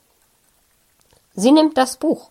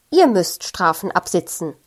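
plain reading voice

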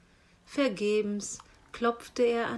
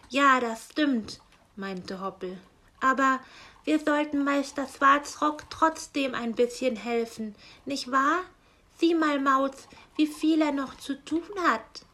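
higher, louder gentle rabbit voice; lively rabbit Hoppel; 5 semitones higher, 6 dB louder than usual. Acted. Rabbit Hoppel persuades Mauz to help the raven, higher and coaxing. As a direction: coax your friend kindly, bright and up.

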